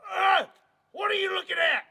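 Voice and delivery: shouting in alarm; startled yell. Acted. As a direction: yell at the intruder. Startled by bear, yelling.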